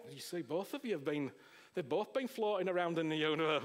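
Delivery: normal talking voice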